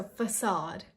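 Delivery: facade as "fuh-SAHD"; correct pronunciation. Shown as a demonstration. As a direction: say facade with a long ah. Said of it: "the pronunciation is facade".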